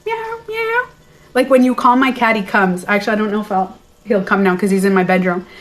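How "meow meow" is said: high cat meow imitation.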